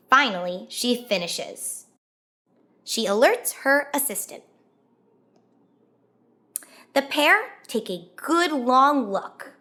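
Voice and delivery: ordinary delivery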